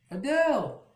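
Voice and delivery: high, loud call across the room; calling out a name. Retold or imitated. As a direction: call her name out as she walks in. Someone calls Adele on arrival.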